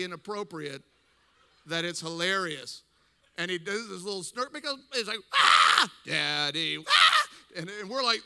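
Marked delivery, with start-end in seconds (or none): 5.3-7.1 loud screams around a chanted word; mock scream-chant. Brother mocking wife and sister, screamed imitation. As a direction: mimic the wailing and chanting at full volume.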